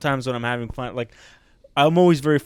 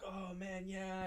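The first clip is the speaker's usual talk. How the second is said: soft, higher murmur of admiration; hushed retold praise; 7 semitones higher, 21 dB quieter, much slower than usual. Fans praising the set afterwards, retold in a hush. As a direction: recount the crowd's praise very softly.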